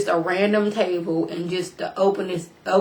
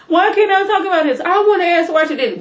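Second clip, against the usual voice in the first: much higher, louder whining son's protest; upset son; 10 semitones higher, 6 dB louder than usual. Acted. Son demands the butler explain, in high upset voice. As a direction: play the son pleading to speak out.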